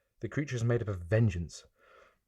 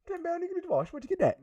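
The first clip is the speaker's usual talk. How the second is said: incredulous Cockney buyer. Acted. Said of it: "Like a Del Boy and Rodney".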